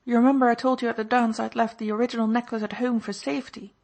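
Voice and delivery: indignant Baroness. A narrator's reading. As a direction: vent your outrage at the husband. Indignant Baroness voiced higher.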